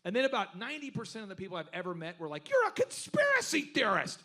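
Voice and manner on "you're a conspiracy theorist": high, loud mocking accuser voice. Mocking the people who call him a conspiracy theorist, high and loud. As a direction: sneer it like a scoffing skeptic.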